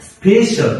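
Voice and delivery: স্পেশাল high, as "SPE-shal", falling at the end; pronunciation example. Shown as a demonstration. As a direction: model স্পেশাল with a high first syllable. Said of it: "আরো একটা হতে পারে". (স্পেশাল উঁচু স্বরে, উচ্চারণ দেখিয়ে)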